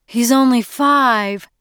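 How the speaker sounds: high, slow, anxious objection; pleading protest. A narrator's reading. Mother defending small son to harsh father, pleading. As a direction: plead for the child, stretching the words.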